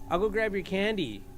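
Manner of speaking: ordinary talk, nothing marked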